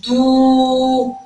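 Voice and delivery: دو held long on a steady oo; drawn-out دوووو; strongly stretched vowel. Shown as a demonstration. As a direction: stretch دو out on a single note. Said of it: "بمد قوي".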